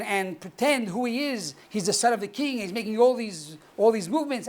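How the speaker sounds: ordinary talk, nothing marked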